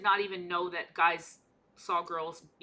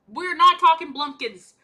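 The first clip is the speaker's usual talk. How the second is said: loud declaration; 6 semitones higher, 12 dB louder, slower than usual. Pretend.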